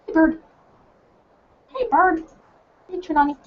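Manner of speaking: high, sing-song call to the bird; calling the pet. Real, not acted.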